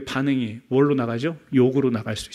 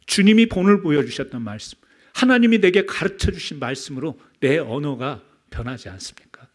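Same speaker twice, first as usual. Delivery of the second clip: normal talking voice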